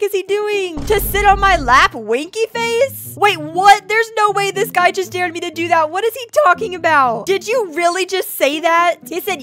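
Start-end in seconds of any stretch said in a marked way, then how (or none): none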